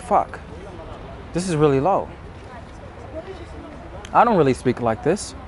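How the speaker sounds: subdued, inward realization; quietly noticing your own held-back voice; low voice. Acted. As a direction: talk low as if to yourself. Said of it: "in this low voice".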